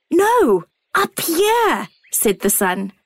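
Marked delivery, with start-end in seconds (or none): 0.1-1.9 bright, calling sun voice; the sun calling down kindly; warm beaming sun voice. A narrator's reading.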